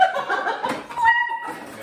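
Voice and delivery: high shrieking burst of laughter. Real, not acted. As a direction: let out a shrill laugh. Friend's cooking mishap.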